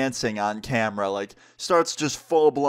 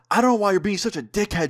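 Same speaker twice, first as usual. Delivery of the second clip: high-pitched mock protest from a tipsy mother; defensive drunk mom; 9 semitones higher, faster than usual. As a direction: argue back as the offended mom. Acted drunk mom arguing back.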